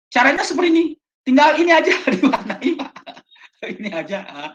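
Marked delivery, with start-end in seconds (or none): 0.1-2.7 1 excited. Real, not acted. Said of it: "Keren, Pak".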